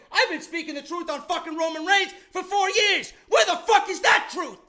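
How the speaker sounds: high, loud exasperated rant; angry rant. Real, not acted. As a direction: shout it in outraged frustration. Ranting about Roman Reigns truth.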